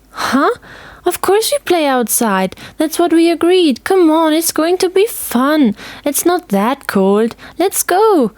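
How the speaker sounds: put-on high, bouncy girl's voice; excited girl coaxing her friend to play; eager little girl. A narrator's reading. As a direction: pull your friend along with glee.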